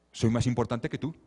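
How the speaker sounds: ordinary talk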